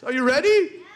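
normal talking voice

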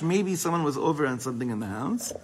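normal talking voice

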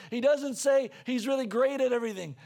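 normal talking voice

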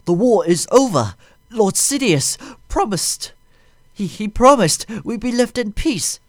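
high, loud frightened alien plea; panicked high pleading. A narrator's reading. Nute Gunray begging Vader, high panicked plea. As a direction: beg in terror. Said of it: "he whimpered".

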